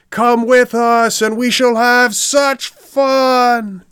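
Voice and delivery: high, slow, drawn-out group shout; shouted in chorus. A narrator's reading. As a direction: call out together, coaxing and stretched. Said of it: "shouted in chorus a hundred voices".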